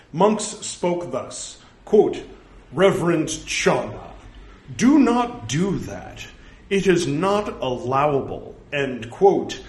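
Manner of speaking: loud, emphatic reading with slow phrasing; formal scripture recitation. Acted. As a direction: declaim the text, punctuation included. Reads scripture aloud.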